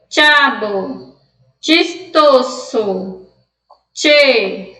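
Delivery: CHAVO, CHISTOSO, CHE each with "tch"; Spanish CH. Shown as a demonstration. Teacher reviewing the word list for students, Spanish CH. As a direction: slowly list "tchabo, tchistoso, tche". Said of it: "a pronúncia do C H".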